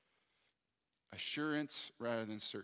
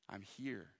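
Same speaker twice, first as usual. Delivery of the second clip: soft, hushed reassuring words; gentle reassurance; 3 semitones lower, 7 dB quieter than usual. Retold or imitated. God's voice telling the anxious pastor not to fear, hushed and soft. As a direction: speak softly, as if calming a frightened child. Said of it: "what God was speaking to me".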